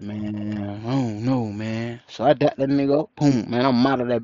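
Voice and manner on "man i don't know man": low, soft imitation of a brother; resigned reply. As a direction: shrug it off low and quiet.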